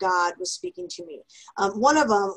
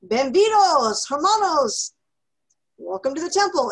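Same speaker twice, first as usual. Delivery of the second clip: mock announcer greeting, higher and louder; temple announcer; 6 semitones higher, 6 dB louder than usual. Imitating the Spanish-speaking temple announcer's welcome. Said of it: "he says".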